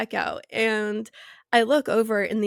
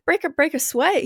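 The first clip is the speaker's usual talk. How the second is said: higher, urging dad impression; gung-ho dad; 7 semitones higher than usual. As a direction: play a keen dad pushing everyone on. Acting out gung-ho dad's reply.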